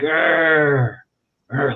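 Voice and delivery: mock animal snarl, steaming with anger. Arnold refusing to answer the publicist, imitated snarl. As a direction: growl back wordlessly, like an annoyed beast. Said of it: "kept snarling".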